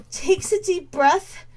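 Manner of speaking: ordinary delivery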